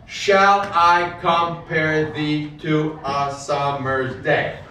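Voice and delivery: slow, beat by beat delivery; metrical recitation. Shown as a demonstration. Teacher reading the line to show the meter. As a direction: lean into each beat of the meter. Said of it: "So there's ten syllables".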